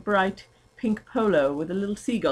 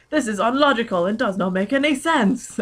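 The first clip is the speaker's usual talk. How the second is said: hammed-up bellow, louder and higher; mock bellow; 6 semitones higher, 5 dB louder than usual. Ebony rants at the air in a mock bellow. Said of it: "Ebony bellowed out into the air".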